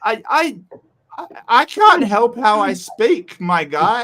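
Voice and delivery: high, loud, drawn-out exasperation; exasperated protest. Real, not acted. Defending his accent to critics, high exasperated protest. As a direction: protest loudly, voice pitched right up.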